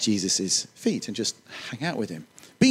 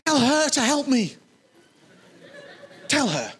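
demanding Martha; 12 semitones higher than usual. Retold or imitated. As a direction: insist that Jesus tell her sister. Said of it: "She says this".

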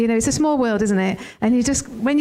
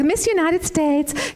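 slow, higher American pageant-host delivery; mock American pageant accent; 5 semitones higher, much slower than usual. Co-pastor imitating a beauty pageant in American accent. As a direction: draw out "Miss United States" like a pageant announcement in American accent. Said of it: "she's like".

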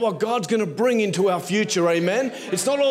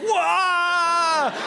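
high shouted wah; mock crowd cheer; 9 semitones higher than usual. The pastor imitates students cheering Sachi's sermon with a high shouted wah.

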